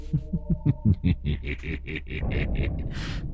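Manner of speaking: put-on a possessed girl's muffled cackle; eerie cackle. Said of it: "như đang cười khanh khách". (tiếng cười khanh khách rợn người)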